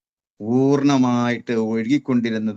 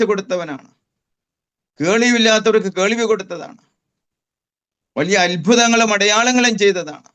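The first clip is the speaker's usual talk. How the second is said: fervent prayer; 7 semitones higher than usual. Retold or imitated. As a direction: cry out praise to the Lord, climbing. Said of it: "പ്രാർത്ഥിക്കാൻ തുടങ്ങി". (ഉയർന്നുവരുന്ന തീക്ഷ്ണമായ പ്രാർത്ഥന)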